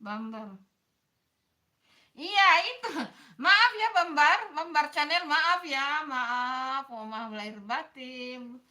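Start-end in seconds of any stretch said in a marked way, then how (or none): 2.2-6.8 loud apology. Real, not acted. Loud apology for misreading name.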